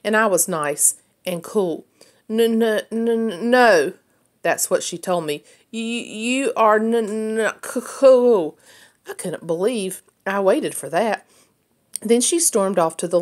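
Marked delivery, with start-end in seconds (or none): 2.3-3.9 halting, stuttered no; struggling to get the word out; stammering no. A narrator's reading. Betsy stammers out her answer. 5.7-8.6 slow, halting stutter on every word; slow stammer. A narrator's reading. Betsy stammers slowly.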